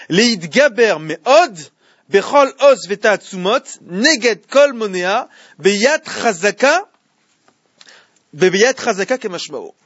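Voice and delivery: slow, loud chanted reading of the Hebrew; Hebrew text recited. Acted. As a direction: intone the verse slowly like a study chant. Relit le texte hébreu. (lecture solennelle et lente du texte hébreu)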